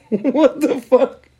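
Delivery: high, slow laughing what the fuck; high, drawn-out incredulous laugh; laughing disbelief. Real, not acted.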